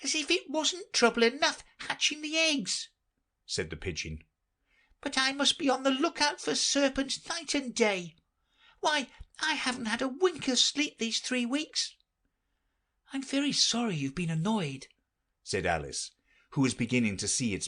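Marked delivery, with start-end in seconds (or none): 0.0-11.9 very high, fussy bird voice; fretful pigeon. Acted. The pigeon grumbling about serpents, very high fussy voice. 13.1-14.9 put-on high, polite young girl; Alice's voice. Alice apologising to the pigeon, polite high girl voice.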